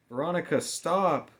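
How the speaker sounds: high, loud cry of desperation; girl shouting in despair; desperate scream. A narrator's reading. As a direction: scream her name to stop her. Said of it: "Mamie screamed in desperation".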